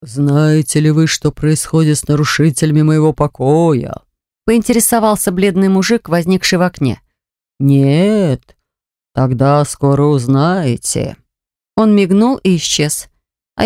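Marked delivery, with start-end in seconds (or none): 0.0-4.0 ghostly threat. Acted. 7.6-11.2 low, slow eerie voice; ghostly threat. Acted.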